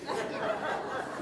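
airless chuckle under the breath; breathy silent laugh. Real, not acted. Preacher laughing at his own marriage joke. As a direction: laugh quietly to yourself.

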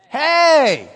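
sharp loud call for attention. Real, not acted. Teacher calling out to a drifting student. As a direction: snap the class to attention.

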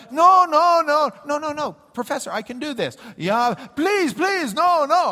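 high, loud panicked no, no, no; mock frantic pleading. Teacher frantically stops a student saying the name. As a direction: beg her to stop before she says it.

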